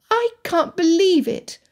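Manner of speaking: high-pitched amazement; astounded. A narrator's reading. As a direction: say it in sheer disbelief.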